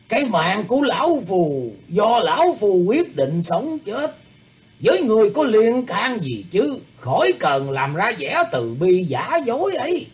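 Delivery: cold scorn. A narrator's reading. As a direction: spit out contempt coldly. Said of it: "hừ một tiếng lạnh nhạt nói".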